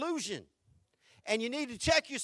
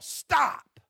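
exclaimed stop; 3 semitones higher, 5 dB louder than usual. Retold or imitated. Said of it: "Exclamation point".

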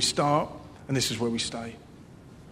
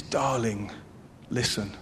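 low, soft and tender; God tenderly calling his child; soft fatherly call; 4 semitones lower, 6 dB quieter than usual. Acted. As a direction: call gently like a loving father. God's voice called from heaven.

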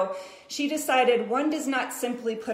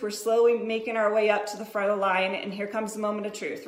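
usual speaking voice

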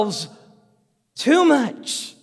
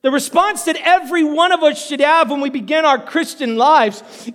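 normal talking voice

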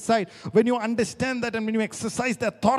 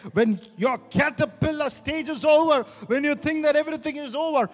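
loud, high shouted preaching; preaching at full force; 4 semitones higher, 12 dB louder than usual. Acted. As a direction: build the sermon to a roaring peak.

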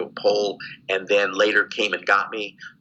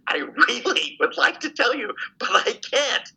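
retold earnest plea; 14 semitones higher than usual. Prisoner refusing the lieutenant as guards close in, retold earnest plea. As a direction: imitate pleading regret to an interrogator.

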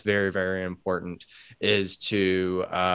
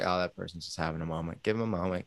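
much softer, quick understanding murmur; gentle hush; 6 semitones lower, 8 dB quieter, much faster than usual. Pretend. Bystander calmly excusing a crying stranger, softly. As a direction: soothe the room, softly and quickly.

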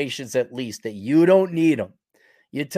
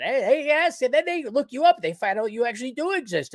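high mock friend voice; 7 semitones higher than usual. Mimicking friends' reaction.

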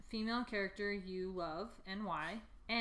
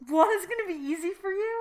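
much higher, louder disbelief; surprised; 10 semitones higher, 9 dB louder than usual. Real, not acted. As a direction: ask it in total disbelief. Incredulous reply to friend.

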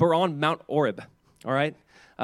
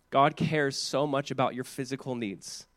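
usual speaking voice

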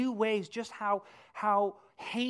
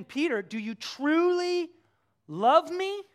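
pleading question; 8 semitones higher, 8 dB louder, slower than usual. Retold or imitated. Jesus pressing Peter again.

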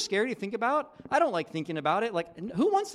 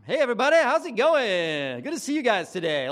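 forced cheery greeting; 3 semitones higher, 7 dB louder than usual. Pretend. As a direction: put on the big friendly host voice. Acting out forced friendly greeting.

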